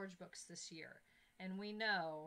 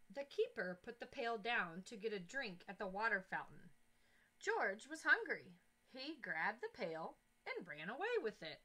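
put-on reading to children; 4 dB louder than usual. A narrator's reading. Teacher reads story aloud.

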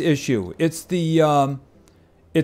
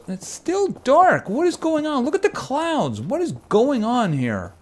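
surprised; 8 semitones higher than usual. Real, not acted. Gloomy sky in the game.

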